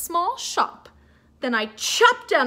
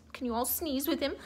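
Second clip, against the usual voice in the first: usual speaking voice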